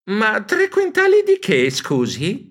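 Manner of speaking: high puzzled question; baffled. Acted. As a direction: ask it, utterly lost.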